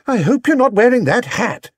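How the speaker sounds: a mother eyeing an odd hat; Mrs Brown, doubtful. Acted. Mrs Brown to Paddington, lightly disapproving.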